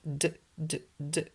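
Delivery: "D, d, d" as short separate consonant sounds; short soft "duh" for each "D, d, d"; repeated sound. Shown as a demonstration. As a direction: tap out "d" three times, softly, with gaps. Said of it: "et le répète".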